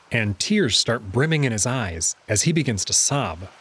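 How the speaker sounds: usual speaking voice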